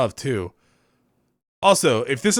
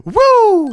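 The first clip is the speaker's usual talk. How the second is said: high, loud imitated whoop; impression cheer, pitched way up and loud; imitation whoop; 11 dB louder than usual. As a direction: let out a big mock cheer. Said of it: "that was a remarkably good imitation".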